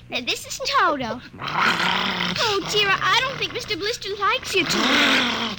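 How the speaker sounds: ordinary talk, nothing marked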